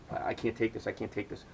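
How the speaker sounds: fading out, at the end of his rope; overwhelmed mutter. Retold or imitated. Sick and scared at the trailer door, soft despairing. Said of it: "I'm like".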